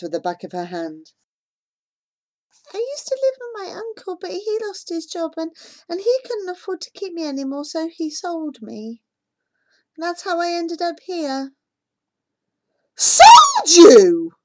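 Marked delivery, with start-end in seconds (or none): 2.7-11.5 put-on higher, softer, a touch quick young girl; a hint of a timid little girl; small girl's voice. Young sold girl speaks. 13.0-14.3 much louder, higher disbelieving question; shocked. Acted. Shocked reaction.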